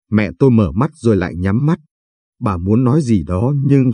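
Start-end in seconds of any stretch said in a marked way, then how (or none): none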